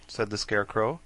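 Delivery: ordinary delivery, nothing marked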